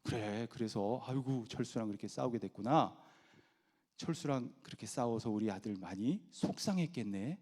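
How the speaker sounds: soft, low, soothing parent; empathetic parent. Acted. Parent comforting son after a fight, soft empathy. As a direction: gently acknowledge the child's hurt. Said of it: "속상한 감정까지 공감을 해주는".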